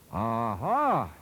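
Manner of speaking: a loud, roaring shout; roared. A narrator's reading. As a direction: bark out a drunk's roar. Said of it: "he roared".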